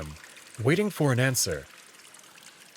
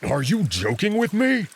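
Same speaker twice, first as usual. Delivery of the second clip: loud, higher incredulous growl; outraged wolf; 7 semitones higher, 4 dB louder than usual. Acted. Wolf shouting after the flirting jackal, outraged. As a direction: bark it out in disbelief.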